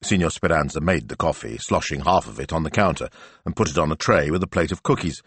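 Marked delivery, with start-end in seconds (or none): none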